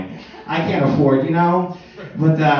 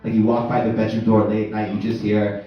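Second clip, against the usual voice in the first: ordinary talk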